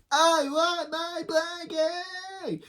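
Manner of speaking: very high, drawn-out wail; tantrum yelling. Acted. Menace Khloe yelling for her blankie, high wail. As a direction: yell it high like a tantrum, stretching each word. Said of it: "Menace Chloe's yelling".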